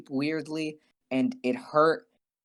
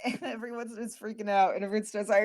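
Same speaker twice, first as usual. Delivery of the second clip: ordinary delivery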